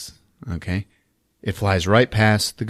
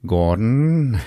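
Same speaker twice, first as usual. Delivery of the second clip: brooding monster; 2 semitones lower, much slower than usual. Acted. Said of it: "he's like".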